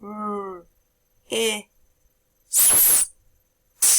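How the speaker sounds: "R, I, S, T" as four separate sounds; sounding out words. Shown as a demonstration. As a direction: segment "R, I, S, T" sound by sound with pauses. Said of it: "Here we go".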